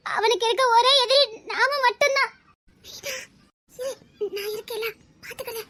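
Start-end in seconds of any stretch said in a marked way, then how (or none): 2.8-5.6 hushed reassurance. Acted. Woman tells Meena she is there, hushed reassurance. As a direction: murmur it softly to steady her.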